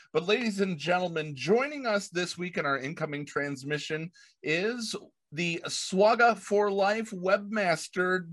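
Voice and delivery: usual speaking voice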